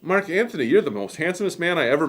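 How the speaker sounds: pitched well up, swooning over him; flirty Cleopatra. Retold or imitated. Teacher voices Cleopatra flattering Mark Antony, high swooning gush. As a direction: gush like a smitten queen, lightly played. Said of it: "Cleopatra's like".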